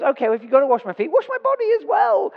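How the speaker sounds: rushed, rising to a very high squeal; eager Peter. Retold or imitated. Peter now begging Jesus to wash all of him, rushed high squeal. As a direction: gabble it fast, climbing to a squeak.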